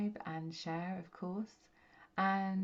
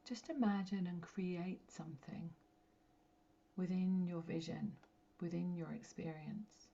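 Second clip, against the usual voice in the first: guided meditation. Real, not acted. Medium guiding listeners to connect with their light, meditative. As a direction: guide them gently inward, phrase by phrase, letting each settle.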